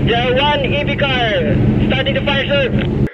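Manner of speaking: loud, high urgent report; urgent shouted report. Acted. Crew member reports fire urgently.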